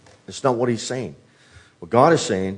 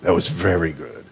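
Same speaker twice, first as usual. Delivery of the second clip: softer, lower, savoring the meal; mild, relishing after dinner; contented sigh; 9 semitones lower, 4 dB quieter than usual. Retold or imitated. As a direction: lean back full and content. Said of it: "pushed back your chair and says".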